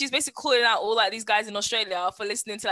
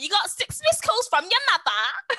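high-pitched Australian catchphrase; Australian girl; 9 semitones higher, 4 dB louder than usual. Retold or imitated. Friends quoting the Australian girl's line, high mock Australian accent. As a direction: quote the Aussie girl in a raised voice. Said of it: "we're always like".

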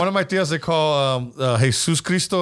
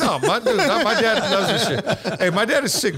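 laughing protest; 7 semitones higher than usual. Real, not acted. Laughs off the friend's jab.

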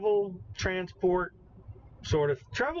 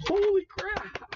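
amazed; 14 semitones higher, slower than usual. Real, not acted. Still amazed.

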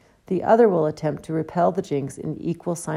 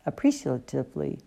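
ordinary delivery

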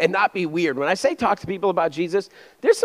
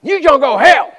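put-on southern accent; 10 semitones higher, 10 dB louder than usual. Preacher mimics the freaky evangelist once more, loud southern accent. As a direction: bellow it like a caricatured southern evangelist. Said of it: "they always have that southern accent".

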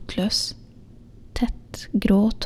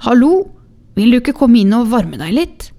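high, loud friendly call from the doorway; 4 semitones higher, 7 dB louder than usual. Acted. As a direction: call her in warmly and loudly. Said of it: "da han ropte". (roper høyt og vennlig fra døra)

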